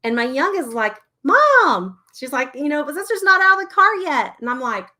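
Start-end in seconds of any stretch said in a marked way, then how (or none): 1.2-2.0 put-on high, loud child's cry for Mom; imitating an alarmed little kid; child calling out. 2.8-4.3 put-on high, loud kid tattling urgently; imitating a child's urgent protest; imitated child. Child reporting to mom in alarm.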